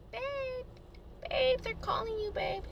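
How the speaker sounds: high, soft cooing imitation; girl waking boyfriend. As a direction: gently rouse a sleeping boyfriend. Voices girl waking her boyfriend.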